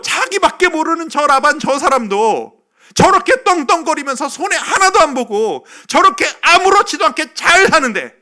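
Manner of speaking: high, very loud anguished protest to God; desperate crying out. Acted. Jacob pouring out his grievance to God, desperate shouted complaint. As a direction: cry out your grievance at full force, pride thrown away. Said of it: "막 부르짖었을 것 같아요".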